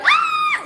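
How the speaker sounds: very high overjoyed shriek; excited squeal. Acted. Speaker sees a forgotten friend again, high excited squeal. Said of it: "I'm excited, I'm overwhelmed".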